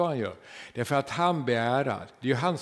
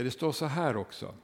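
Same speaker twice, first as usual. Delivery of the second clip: ordinary delivery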